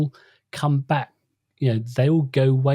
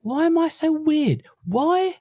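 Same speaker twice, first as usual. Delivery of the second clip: high-pitched, mock-anguished younger self; retold self-questioning. Boy questioning himself in biology class, high retold bewilderment. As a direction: act out a schoolboy baffled by himself, pitched way up. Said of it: "can remember sitting in, but in b-, biology going".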